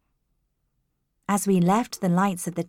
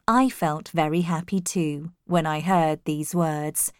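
ordinary delivery

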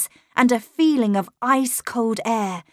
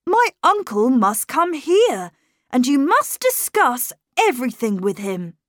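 put-on insistent boy; 4 semitones higher, 4 dB louder than usual. A narrator's reading. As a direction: lay down the law like a bold child.

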